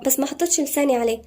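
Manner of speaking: ordinary talk, nothing marked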